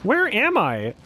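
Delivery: high-pitched incredulous question; baffled outcry. Real, not acted. Player baffled by a water glitch, incredulous.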